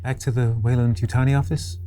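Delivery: normal talking voice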